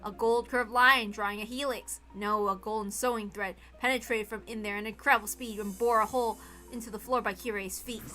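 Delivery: ordinary delivery, nothing marked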